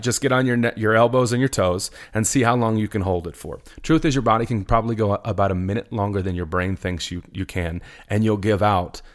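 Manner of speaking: normal talking voice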